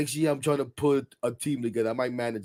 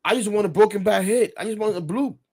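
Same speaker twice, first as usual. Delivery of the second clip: frustrated player whining; 7 semitones higher, 4 dB louder than usual. Retold or imitated. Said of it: "Guys are like".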